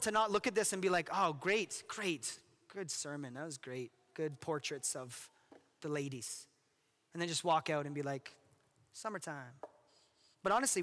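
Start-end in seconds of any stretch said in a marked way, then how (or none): 1.1-6.5 trailing off softer, slow, unimpressed; bored dismissive churchgoer. Acted. 8.9-9.5 soft, breezy throwaway word; careless shrug-off. Acted. Walking out carefree.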